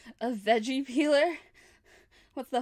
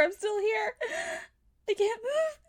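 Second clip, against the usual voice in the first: 1 crying; 6 semitones higher than usual. Acted. Trapped, pleading captive.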